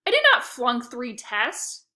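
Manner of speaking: slow, loud angry denial; snarling. A narrator's reading. Lexi snarls at her brother, slow and loud. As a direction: bite out each word. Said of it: "Lexi snarled".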